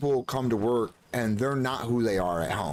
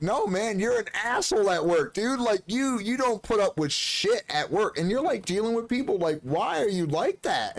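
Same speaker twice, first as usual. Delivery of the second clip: retold teasing; 8 semitones higher than usual. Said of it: "and I'm like".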